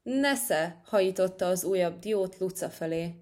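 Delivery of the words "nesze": higher, short angry exclamation; storybook flash of temper; furious. A narrator's reading. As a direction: toss the word out as with a throw. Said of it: "A nyúl égtelen dübe gurult".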